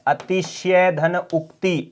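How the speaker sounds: अतिशय धन उक्ति said slowly, parts split apart; slow breakdown. Shown as a demonstration. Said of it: "अतिशयोक्ति बनता है". (अतिशय धन उक्ति को धीरे-धीरे टुकड़ों में बोलना)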